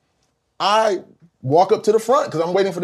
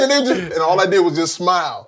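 normal talking voice

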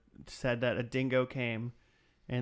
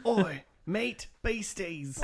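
laconic outback drawl; 6 semitones higher, much slower than usual. Acted. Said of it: "Yeah, Australian?".

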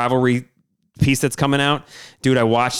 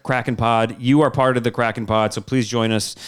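ordinary talk

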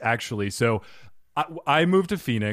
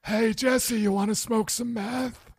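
high, put-on stoner voice; goofy higher-pitched dude voice; Badger impression; 9 semitones higher, 3 dB quieter than usual. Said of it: "accidentally doing a Badger impression".

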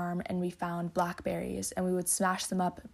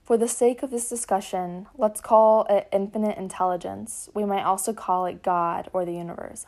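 reading aloud; 5 dB louder than usual. Acted.

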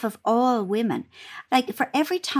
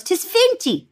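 mum's voice; 11 semitones higher, slower than usual. Retold or imitated. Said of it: "And she goes".

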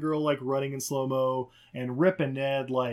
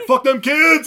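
loud, higher yell; shout; 10 semitones higher, 13 dB louder, slower than usual. Real, not acted. Cheering Steve kicking the kids, loud yell. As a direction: bellow it like a battle cry.

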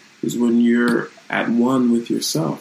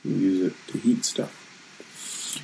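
hushed punchline; 7 dB quieter, much slower than usual. Pretend. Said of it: "deadpan punchline".

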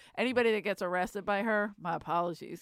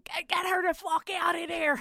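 mock yell; 8 semitones higher than usual. Friend kicking the cop car door, yelling to free her. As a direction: demand they let her out.

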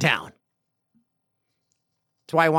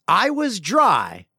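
teasing quote; 5 semitones higher, 5 dB louder, much slower than usual. Retold or imitated. Host teasing Max over his self-description, mock quoting. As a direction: echo it slowly with teasing emphasis on DRY. Mockingly repeats the listener's line.

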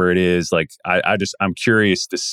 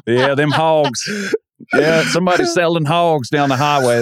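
higher-pitched Kentucky hog-seller bit; mock Kentucky accent; 7 semitones higher than usual. Riffing on co-host's slip about the name, mock Kentucky accent. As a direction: play a Kentucky country seller for laughs. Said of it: "sound like he's from Kentucky".

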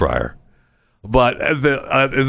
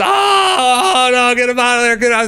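panicked shrieking; 13 semitones higher, 3 dB louder than usual. Retold or imitated.

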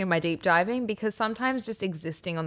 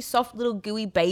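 ordinary talk, nothing marked

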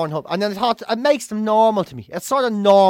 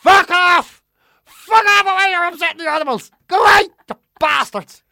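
furious roar, much higher and louder; 10 semitones higher, 7 dB louder than usual. Retold or imitated. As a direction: roar them off your doorstep. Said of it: "roaring out the other one".

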